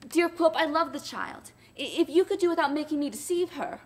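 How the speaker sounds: put-on wife pleading with Quilp for the child; timid pleading wife. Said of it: "The submissive woman entreated him".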